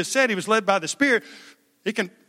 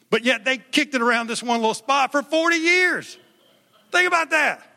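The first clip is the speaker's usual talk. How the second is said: excited emphasis; 6 semitones higher than usual. Real, not acted. Preacher building to emphatic point.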